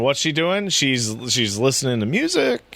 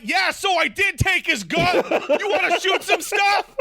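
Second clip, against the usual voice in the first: much higher, shouted mock teen voice, laughing; excited Chloe. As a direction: yell it like a reckless teen, pitched way up. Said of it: "And she's like".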